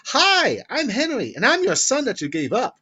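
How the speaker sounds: put-on high, loud, bouncy little-boy voice; Henry. Little boy on her doorstep, high loud kid voice. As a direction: chirp it loudly like an eager little kid. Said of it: "she sees a little boy".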